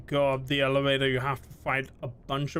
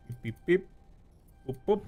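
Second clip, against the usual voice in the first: robot beeps; 5 semitones higher, faster than usual. Pretend. Imitating robot noises.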